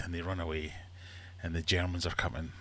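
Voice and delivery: usual speaking voice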